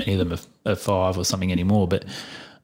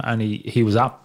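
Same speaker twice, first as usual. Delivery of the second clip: ordinary delivery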